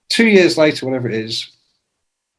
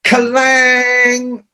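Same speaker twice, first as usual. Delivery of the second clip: high ringing shout of a guitar chord; guitar crash shout; 11 semitones higher than usual. Retold or imitated. Rock writers answering the phone, high shouted guitar-crash noise. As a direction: belt it like a power chord. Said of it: "we would go".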